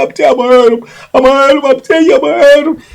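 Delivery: high, worked-up mock threatening; imitated agitated threat. Mimicking the jealous man threatening his rival, high agitated voice.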